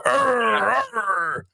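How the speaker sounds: a mock pirate-like arr, then trailing off; mocking arr grunt. To the caller Kev, mocking his arguments: mock arr grunt. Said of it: "All you do is go".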